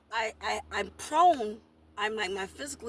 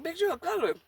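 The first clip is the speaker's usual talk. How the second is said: mock friend voice; 7 semitones higher than usual. Friend scolding her in retold argument.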